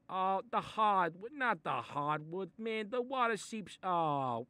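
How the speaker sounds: slow, high, distressed wail; panicked. Acted. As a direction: panic over the floor. Panicking over the wet floor.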